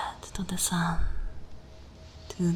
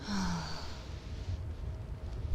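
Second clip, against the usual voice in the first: long, breathy exhale; soft sigh; 11 dB quieter than usual. Acted. Vampire lingers over the human, long soft sigh. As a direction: let out a slow, hushed breath.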